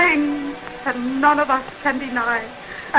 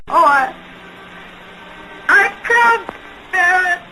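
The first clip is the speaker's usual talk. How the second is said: anguished cry; 6 semitones higher than usual. Acted. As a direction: voice rising high in despair. Anguished confession outburst.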